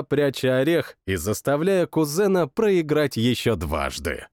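plain reading voice

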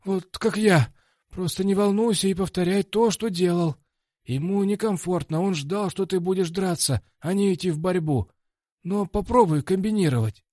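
high-voiced coach. Coach advises his fighter between rounds, high-voiced coaching. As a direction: give fight advice in a high-pitched coach voice.

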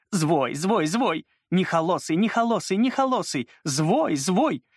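"звой" and "холосый" with r and sh softened, high chirping; childish lisp, "звой" for "злой"; says w for r. A narrator's reading. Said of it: "разнообразно картавя".

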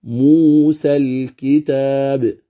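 موسى الكتاب chanted slowly with an open alif; recitation style. Shown as a demonstration. Teacher reciting موسى الكتاب with no tilt. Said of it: "إذن تقرأ".

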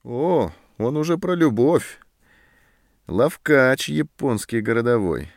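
mocking, drawn-out sneer; sarcastic jab. A narrator's reading. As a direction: needle him with scorn. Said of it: "съязвил сержант".

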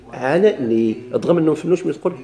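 normal talking voice

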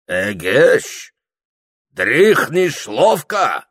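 loud, drawn-out Circassian voice; Circassian character. A narrator's reading. Circassian waking Прохор, loud Circassian voice. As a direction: rouse the sleeper as a Circassian. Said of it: "сказал черкес".

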